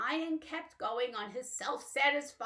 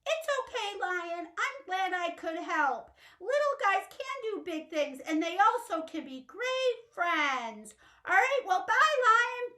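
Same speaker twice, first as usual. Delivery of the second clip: high, gentle little mouse; 8 semitones higher, 4 dB louder than usual. Acted. Mouse saying goodbye to Lion, high and gentle.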